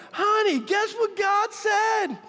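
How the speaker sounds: high, slow, loud gleeful call; excited shout. Retold or imitated. Husband calling out his big news to his wife, thrilled. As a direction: burst through the door with the big news.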